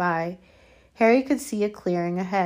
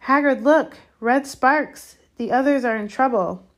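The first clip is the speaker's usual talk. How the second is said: higher, loud urgent cry; urgent alarm; 6 semitones higher, 8 dB louder than usual. Acted. Girl alerts gamekeeper to red sparks, urgent alarm. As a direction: call out the danger in excited alarm. Girl spots distress signal.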